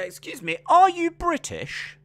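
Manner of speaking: put-on British accent. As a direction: ask it in an exaggerated British accent. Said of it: "why am I putting an accent on".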